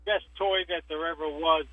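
ordinary talk

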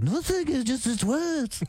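high, drawn-out mock English accent; hammed-up English accent, stretching each word. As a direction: brush off the question in a badly faked English accent. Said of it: "[name]'s like".

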